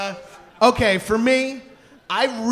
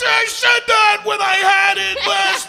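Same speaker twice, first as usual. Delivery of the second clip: loud; 11 semitones higher, 4 dB louder than usual. Real, not acted. Excited overlapping claim.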